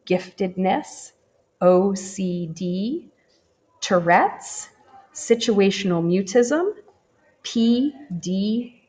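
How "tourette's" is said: ordinary delivery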